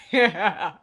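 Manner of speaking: high squeaky laughter through words; laughing hard. Real, not acted. Laughing hard while narrating.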